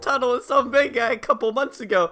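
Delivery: high, loud, laughing panic; terrified henchman squealing through laughter; panicked thug. Acted.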